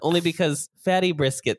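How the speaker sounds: ordinary talk, nothing marked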